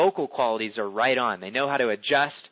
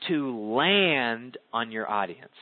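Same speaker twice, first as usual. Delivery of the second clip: slow tempo demo; much slower than usual. Shown as a demonstration. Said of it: "that's what it means by tempo".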